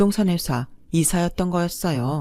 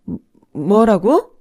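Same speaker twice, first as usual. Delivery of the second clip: slow, stammering disbelief; the mother hears the bride refuse to marry, slow and shocked; stunned; 4 semitones higher, 7 dB louder, much slower than usual. A narrator's reading. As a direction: stumble on the first word in shock.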